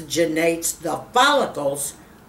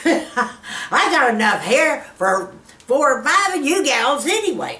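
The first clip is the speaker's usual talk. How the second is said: laughing; 7 semitones higher than usual. Real, not acted. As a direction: giggle through it, amused. Laughing about excess hair.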